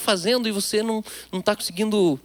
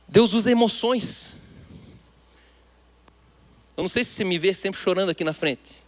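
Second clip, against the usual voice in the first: normal talking voice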